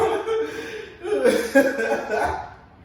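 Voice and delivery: loud helpless laughing fit; cracking up loudly; loud laughter. Real, not acted. Keeps laughing, can't stop.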